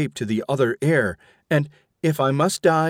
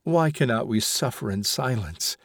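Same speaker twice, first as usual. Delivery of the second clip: ordinary delivery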